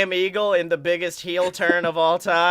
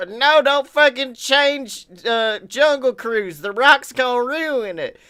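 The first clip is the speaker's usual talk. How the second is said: mock protest; 6 semitones higher, 5 dB louder than usual. Mock protester over ridiculous cause.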